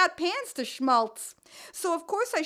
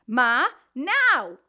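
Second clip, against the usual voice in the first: insisting daughter; 8 dB louder than usual. Acted.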